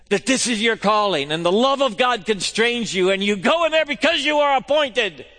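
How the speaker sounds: climbing loud and high; sermon climax for teachers; fervent preaching. Acted. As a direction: lift the teachers up.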